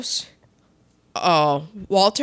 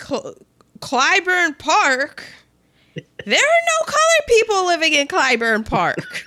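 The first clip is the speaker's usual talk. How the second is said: shocked Ruth; 8 semitones higher than usual. Retold or imitated. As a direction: gasp it out in disbelief. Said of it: "Ruth's like".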